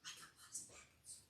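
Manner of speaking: usual speaking voice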